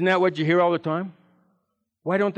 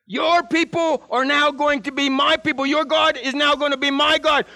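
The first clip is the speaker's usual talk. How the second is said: put-on higher, earnest voice of a devoted young woman; Ruth pleading; 9 semitones higher than usual. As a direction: plead to stay, pitched up. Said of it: "Ruth says".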